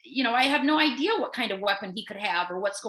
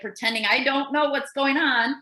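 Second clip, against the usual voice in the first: ordinary talk, nothing marked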